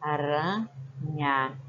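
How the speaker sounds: Aranha stretched slowly, syllable by syllable; teacher drawing out Aranha for kids; slow drawn-out word. Shown as a demonstration. Teacher to pupils, slowly spelling out Aranha. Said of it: "Se eu tirar um R".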